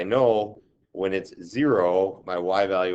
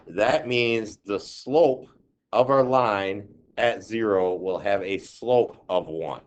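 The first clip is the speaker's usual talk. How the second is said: ordinary delivery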